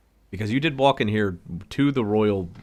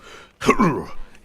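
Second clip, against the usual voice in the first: throat clear; 6 semitones higher, 4 dB louder than usual. Acted. Guard about to lift someone.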